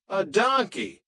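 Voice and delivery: plain reading voice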